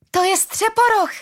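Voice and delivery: put-on very high, excited child's cry; small child shouting. A narrator's reading. Small child spots Střeporoch while dragged away, shouting. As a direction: pipe it up high like a little child. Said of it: "křiklo malé dítě".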